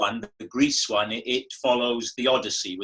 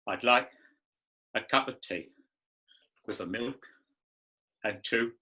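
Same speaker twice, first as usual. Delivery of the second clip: emotional Caine voice; 2 semitones lower, 6 dB quieter than usual. Retold or imitated. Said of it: "for him to be emotional".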